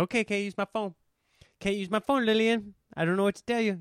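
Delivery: a hint of a fed-up father talking to his wife; Eddie Murphy's dad. Dad telling Lillian she can't use the phone, lightly put on. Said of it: "Eddie Murphy's dad in that one special".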